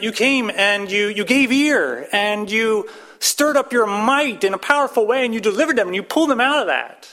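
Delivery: fervent preacher, climbing in intensity; building passionate preaching. Acted. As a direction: preach it harder and harder. Preaching the psalm's plea.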